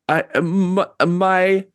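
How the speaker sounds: halting, broken-off start; slight stammer. Acted. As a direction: stick on the first word with pauses. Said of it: "starts to stammer a little bit".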